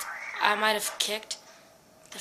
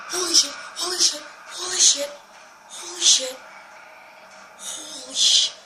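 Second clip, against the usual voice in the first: shocked swearing; 3 semitones higher than usual. Real, not acted. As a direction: keep swearing in shock after a scare. Repeated swearing after jump scare.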